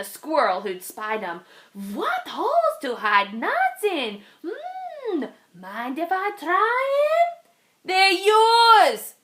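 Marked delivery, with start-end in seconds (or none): 1.7-7.5 squirrel voice. Acted. Squirrel eyes holes. 7.8-9.1 loud, high woodpecker call; a woodpecker welcoming everyone. Acted. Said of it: "called the woodpecker".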